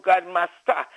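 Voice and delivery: ordinary delivery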